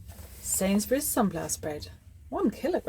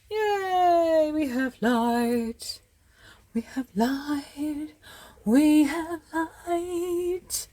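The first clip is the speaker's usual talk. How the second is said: high, long-drawn gleeful cheer; celebrating; 8 semitones higher, much slower than usual. Real, not acted. Cheering to herself that the lamp has light, celebrating. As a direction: stretch every word out high in celebration.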